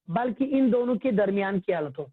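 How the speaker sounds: ordinary delivery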